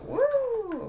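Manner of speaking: loud high startled whoop; sudden whoop. Real, not acted. Vlogger startled mid-sentence, loud high whoop. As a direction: let out a sudden yelp of surprise. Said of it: "Okay, sorry about that".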